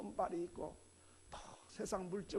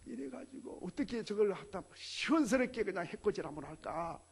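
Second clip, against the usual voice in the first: normal talking voice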